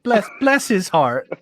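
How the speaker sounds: slow, drawn-out Southern pity; fake-nice Southern drawl; sweet Southern put-down. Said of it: "the really nice Southern way".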